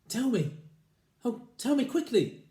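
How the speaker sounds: pleading wife. Acted.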